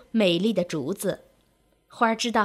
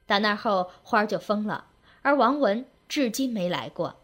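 ordinary delivery, nothing marked

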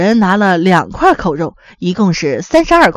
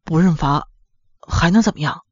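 low, soft resigned grumble; quiet muttering; 6 semitones lower, 5 dB quieter than usual. A narrator's reading. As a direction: mutter it under your breath, helplessly. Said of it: "黄大明小声地嘀咕一句". (小声无奈地嘀咕)

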